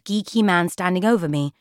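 usual speaking voice

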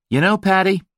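high, hearty American businessman; chummy American voice buttering up his seatmate; brash American. A narrator's reading.

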